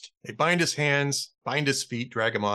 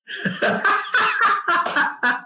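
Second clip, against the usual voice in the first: loud laugh; 5 dB louder than usual. Real, not acted. Laughing at his own punchline.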